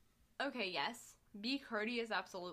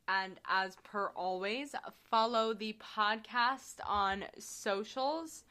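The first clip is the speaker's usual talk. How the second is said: slow, louder announcer plug; podcast outro; 6 dB louder, slower than usual. Acted. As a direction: read the socials like an ad.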